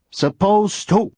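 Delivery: "Supposed to" said with a T, no D sound; "Supposed to" as "suppose-ta" with a clear T; T not D. Shown as a demonstration. Teacher showing "Supposed to" with a T sound and no D. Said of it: "There's no D sound when you say me".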